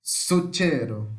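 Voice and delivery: slow "Succeiro" as Italian zucchero, first syllable strong; stress on SU. Shown as a demonstration. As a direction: draw out "Succeiro", weight on the opening SU. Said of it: "Mais forte é o su". ("Succeiro" devagar, com o su mais forte)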